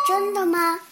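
high, loud skeptical question; teasing surprise. Acted. Jenny teasing Fred.

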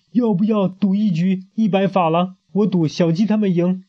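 donkey offers a bet to the pig; sly gambler. Acted.

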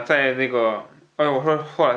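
ordinary talk

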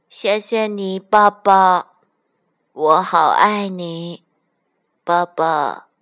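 drowsy little duckling, slowing as it fades; sleepy duckling. A narrator's reading. Duckling under papa's paws, sleepy and loving. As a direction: drawl it sleepily, drifting off. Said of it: "睡眼朦胧地说".

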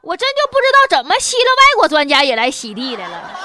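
normal talking voice

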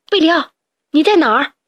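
worried call. Acted. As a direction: shout into the woods. Calling for missing friend.

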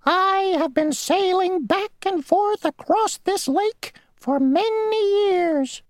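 very high, squeaky and self-important; a tiny insect bragging; the mosquito bragging to the grasshopper; boastful mosquito. Acted. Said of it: "said the mosquito".

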